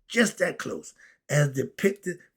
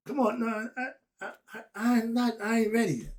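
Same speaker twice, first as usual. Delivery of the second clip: Adam's reluctant voice; 7 semitones higher, faster than usual. Retold or imitated. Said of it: "That's Adam right now".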